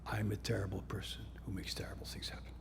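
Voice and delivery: soft, hushed self-blame; quiet inner voice. Retold or imitated. The limbic system voicing self-blame, hushed. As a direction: murmur it like a hidden belief. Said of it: "your limbic system says".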